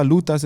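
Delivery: "salutas" said like salute-us run together, stress on the first vowel; "salutas" blended into one word, salu-TAS; prison slang word. Shown as a demonstration. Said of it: "And we used to say".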